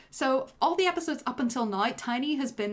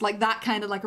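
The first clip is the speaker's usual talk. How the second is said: usual speaking voice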